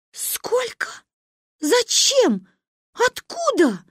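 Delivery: very high, squeaked questions; rising panic, word by word; frightened. A narrator's reading. Mother shocked at the sum demanded, frightened. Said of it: "испугалась мама".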